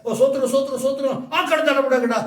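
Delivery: hammed-up, hurried repeated praises; mock praise-shouting. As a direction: imitate a believer's empty praising. Mocks empty praise of careless believer. (போலியான ஸ்தோத்திர முழக்கம்)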